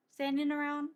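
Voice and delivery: usual speaking voice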